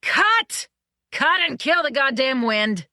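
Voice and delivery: very high, loud shout; a director yelling to kill the wind. A narrator's reading. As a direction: bellow cut angrily.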